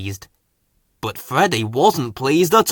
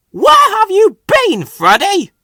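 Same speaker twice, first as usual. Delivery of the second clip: much higher, louder angry snap; snapping; 10 dB louder than usual. A narrator's reading. Cross controller scolding a late Freddy, loud snap. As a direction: bite it off sharply. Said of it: "he snapped".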